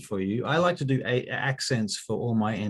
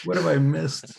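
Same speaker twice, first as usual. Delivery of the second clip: mock voice; 6 semitones higher, 4 dB louder than usual. GM returns, put-on voice.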